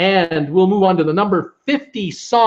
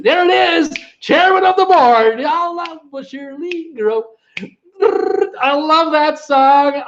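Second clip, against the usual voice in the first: excited; 9 semitones higher than usual. Real, not acted. Cheers for a favourite song.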